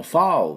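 foul read as fowl, not O; foul said right. Shown as a demonstration. Teacher modelling foul to students, ow vowel. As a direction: say foul with an ow sound. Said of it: "foulって読むんです".

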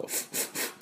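フ as voiceless puffs of air, very soft; short, separate English F puffs for フ; bare F sound. Shown as a demonstration. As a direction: blow each フ with no vowel. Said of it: "Fの音".

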